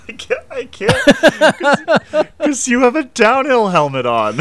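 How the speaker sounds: laughing through the words; can't stop laughing mid-sentence. Real, not acted. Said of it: "you can't keep a straight face right now".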